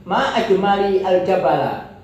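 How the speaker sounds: MA high, falling tune over "ajmali al jabala"; exclamation of wonder. Shown as a demonstration. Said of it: "Intonasinya yang tidak bisa dibohongi".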